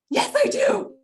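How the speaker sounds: chirpy, proud mock reply; smug singsong reply. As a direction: answer sweetly and a little smug. Her own smug retort retold.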